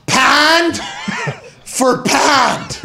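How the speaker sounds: high, booming Pittsburgh "pand for pand"; Pittsburgh accent. Shown as a demonstration. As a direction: shout it in halves with Pittsburgh vowels. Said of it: "I'll say it again".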